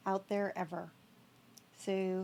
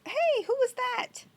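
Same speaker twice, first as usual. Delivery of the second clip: startled shout; 14 semitones higher, 8 dB louder than usual. Real, not acted. Startled by an unknown player.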